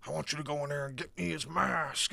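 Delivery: a hint of an unhinged homeless man demanding; crazy bum. Bum under the bridge orders the kid, mildly crazed voice. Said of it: "and the bum's like".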